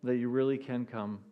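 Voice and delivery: normal talking voice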